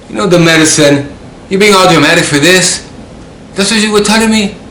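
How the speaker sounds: high, loud, incredulous telling-off; re-enacted loud exasperation; exasperated scolding. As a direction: scold a friend for being dramatic. Acted scolding of dramatic friend.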